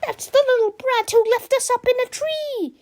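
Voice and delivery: put-on high, loud cross old lady; angry old lady. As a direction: squawk like a furious grandma. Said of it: "very angry old ladies".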